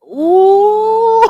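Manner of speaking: long drawn-out high ooh; exasperated ooh. Real, not acted. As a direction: let it out slow, exasperated. Exasperated about her background.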